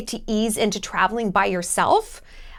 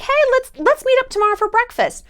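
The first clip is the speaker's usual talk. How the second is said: higher, bright voice, inviting a new friend; bubbly friendly invite; 13 semitones higher than usual. Retold or imitated. To a new travel friend, higher cheery invite. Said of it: "it's like".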